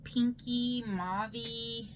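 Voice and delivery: usual speaking voice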